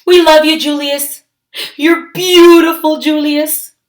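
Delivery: put-on high, doting coo to a baby; cooing parents. Parents cooing lovingly to baby Julius, high and doting. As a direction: gush over the baby.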